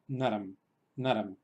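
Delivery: naram ending in a clear closed m; final m demo. Shown as a demonstration. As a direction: say naram, closing the lips on the m. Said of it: "Se pronunciaría".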